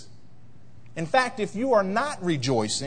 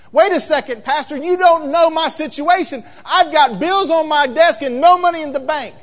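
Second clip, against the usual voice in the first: louder, higher, protesting churchgoer; upset objector; 8 semitones higher, 9 dB louder than usual. Retold or imitated. Pastor voices a troubled believer protesting to him, louder and higher. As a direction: push back hard at the pastor.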